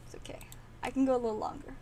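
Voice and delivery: ordinary talk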